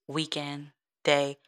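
ordinary talk

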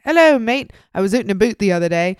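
put-on jokey mix of British and Canadian accents; cheery hammed-up British-Canadian accent; mock British-Canadian accent. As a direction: put on a bad British "mate" with Canadian vowels. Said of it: "had a British or a Canadian accent".

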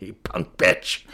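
slow mock-menacing taunt; lightly voiced slow Busey insult; Gary Busey impression. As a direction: drawl it like a slasher villain. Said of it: "channel my Gary Busey".

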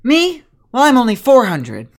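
pep her up, eager to be hired; spunky young trainer. Acted. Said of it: "She goes".

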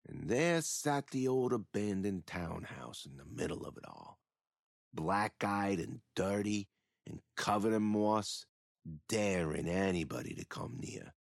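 low, hushed spooky narration; ominous storytelling. Acted. Killer describes the old townhouse to the priest, low and hushed. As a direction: paint the haunted house in a dark murmur.

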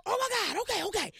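high, loud oh my God; overwhelmed excitement. Retold or imitated. Mom sends her to LAX, loud excited gush. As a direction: gush with disbelief and joy.

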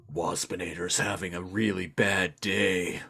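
low, soft buzzy robot-wasp whine; mock Waspinator moping about his day. To the co-host, a low mock Waspinator lament. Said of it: "been like".

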